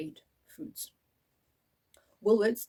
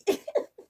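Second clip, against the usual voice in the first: breathy giggle; 7 dB louder than usual. Real, not acted. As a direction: giggle under your breath. Laugh after first bite.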